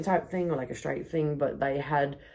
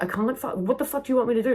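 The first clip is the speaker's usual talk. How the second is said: high fast frustrated cry; fast, high, fed-up yell; exasperated outburst; 9 semitones higher, 3 dB louder, faster than usual. Retold or imitated. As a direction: snap back in disbelief.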